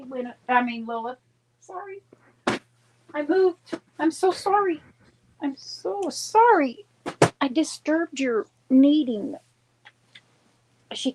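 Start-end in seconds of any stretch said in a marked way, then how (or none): 1.6-9.3 high, sweet pet-talk apology; sorry-to-the-kitty voice; talking to the cat. Real, not acted.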